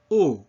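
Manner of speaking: pure rounded French O; firm closed O vowel. Shown as a demonstration. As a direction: say O with lips protruded, no glide. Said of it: "same closed O sound".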